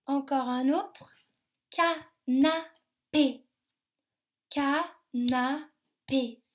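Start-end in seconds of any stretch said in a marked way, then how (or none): 1.7-3.5 CANAPÉ very slow and loud, ca, na, pé; split into syllables. Shown as a demonstration. Teacher has children count, CANAPÉ in three separate syllables. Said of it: "combien de syllabes". 4.5-6.4 CANAPÉ very slow and loud, ca, na, pé; split into syllables. Shown as a demonstration. Teacher has children count, CANAPÉ in three separate syllables. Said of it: "combien de syllabes".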